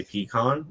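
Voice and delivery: "pecan" said PEE-kahn; long ee in "pecan", ending in kahn; family pronunciation. Shown as a demonstration. Host repeats the family's own way, "pecan" as PEE-kahn. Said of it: "We say pecan".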